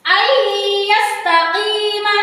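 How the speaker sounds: very slow Ayyastaqima, doubled y held nasally; merged nun recitation. Shown as a demonstration. Teacher shows nun into ya, slow humming chant. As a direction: chant Ayyastaqima slowly, long hummed y at the start. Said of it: "cara membacanya seperti ini".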